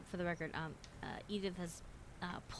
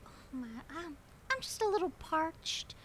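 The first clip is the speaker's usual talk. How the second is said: much higher, fluttery prim lady voice; genteel lady; 9 semitones higher, 9 dB louder than usual. Acted. Edith asking the diner what drinks they have, prim and high. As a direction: flutter politely, a bit overwhelmed. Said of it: "Edith continues and goes".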